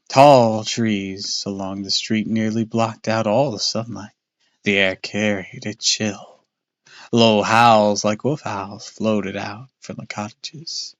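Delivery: plain reading voice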